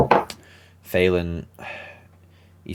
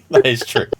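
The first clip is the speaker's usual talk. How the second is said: high laughing reply; 6 dB louder than usual. Real, not acted. GM laughs at the player's eaten-friend joke. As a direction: answer through a laugh.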